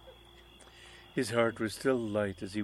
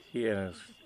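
plain reading voice

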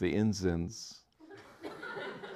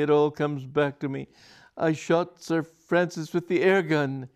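high, louder shuddering confession; 8 semitones higher, 5 dB louder than usual. A narrator's reading. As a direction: suara gemetar ketakutan. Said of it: "shuddering violently".

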